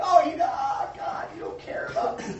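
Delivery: high, loud whiny complaint dissolving into a laugh; shouted whine breaking into a breathy laugh; mock self-pity. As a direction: play the wounded believer, half laughing. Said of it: "we're thinking".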